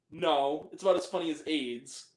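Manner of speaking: ordinary talk, nothing marked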